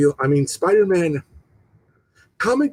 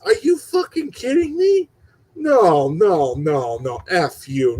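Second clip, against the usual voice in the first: exasperated disbelief; 4 semitones higher than usual. Real, not acted. Exasperated over killed character.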